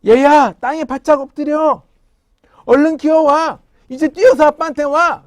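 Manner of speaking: loud, high father's warning call; father yelling to son near a snake; urgent shout. Acted. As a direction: shout the commands to the child. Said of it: "아빠가".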